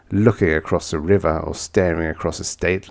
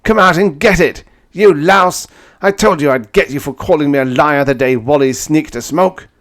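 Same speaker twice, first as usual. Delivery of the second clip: loud, higher, raging taunts; furious challenge; 8 semitones higher, 8 dB louder than usual. Acted. Narrator taunts his enemy to come out, loud rage. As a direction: goad him out to fight.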